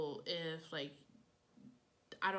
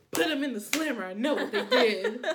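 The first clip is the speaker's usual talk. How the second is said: detective voice; 4 semitones higher, 12 dB louder than usual. Retold or imitated. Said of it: "your detective voice".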